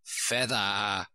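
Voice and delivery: lightly voiced sheep calling out; a mild cry in sheep character; sheep's cry. A narrator's reading. Sheep instructs Alice at the oars, mild cry. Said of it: "cried the sheep".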